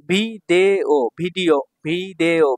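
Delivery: Video stretched out slowly in separate syllables. Shown as a demonstration. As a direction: sound out video beat by beat. Guru mengeja kata Jepang baru.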